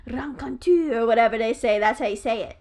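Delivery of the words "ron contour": attempted French pronunciation; French accent. Shown as a demonstration. Showing a co-host how it's said in French, French accent. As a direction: say "Ron contour" as a French word, with French sounds. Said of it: "if you said that in French".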